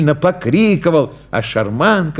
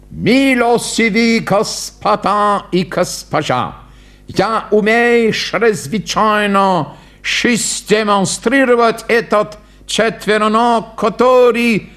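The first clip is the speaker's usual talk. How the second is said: higher, slow showman patter; hammed-up fairground barker; pompous clown; 7 semitones higher, slower than usual. A narrator's reading. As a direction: announce grandly in mangled words.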